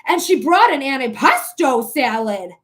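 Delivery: loud, swooping mock-dramatic delivery; Mister Pearl Mania impression. Streamer acting out her salad catchphrase, hammed-up impression. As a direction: punch it out like a big scandal. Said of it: "Just like Mister Pearl Mania".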